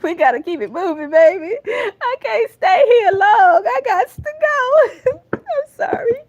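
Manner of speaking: high, breaking voice, crying through the words; fighting back tears while trying to move on; real crying. Real, not acted. Tearful reader telling Virgo to move on, voice breaking. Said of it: "I did not wanna cry today".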